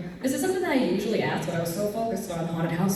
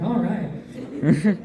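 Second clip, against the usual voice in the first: ordinary talk, nothing marked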